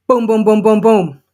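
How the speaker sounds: full-force pounding. A narrator's reading. Sue pounds on Alice's door, loud forceful booms. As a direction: hammer out each boom like a fist on wood. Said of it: "I pound on Alice's door with full force".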